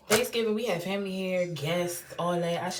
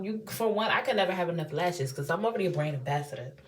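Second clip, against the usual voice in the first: usual speaking voice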